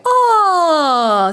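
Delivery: high gliding yawn, falling down; yawn sigh. Shown as a demonstration. Coach demonstrating a morning yawn warm-up. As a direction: let out a big relaxed yawn. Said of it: "Just a really relaxed yaw".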